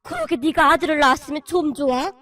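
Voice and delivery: loud, bitter lament; lamenting cry. Acted. Father-in-law lamenting to his daughter-in-law, loud and bitter. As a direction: cry out in regret. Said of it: "한탄스러운 듯 외치셨는데요".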